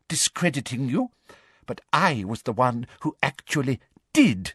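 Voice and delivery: lightly high, gloating threat; menacing Umbridge. Acted. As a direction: savour the threat. Umbridge menacing Harry at wandpoint.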